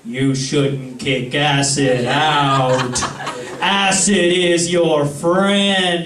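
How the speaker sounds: very slow, booming-slow divine voice; drawn-out mock-divine pronouncement; God. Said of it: "God's face is there going".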